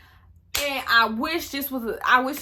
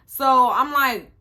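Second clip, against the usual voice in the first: normal talking voice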